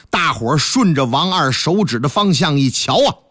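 ordinary delivery

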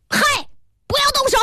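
high-pitched warning yell; sharp cry. A narrator's reading. Pharmacist warning others off the book, crying out high. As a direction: yelp a command to stop. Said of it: "药剂师叫道".

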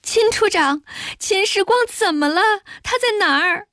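high-pitched tearful plea; feigned crying. A narrator's reading. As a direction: beg for news in a put-on crying voice. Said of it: "哭着问他". (假装哭着高声追问)

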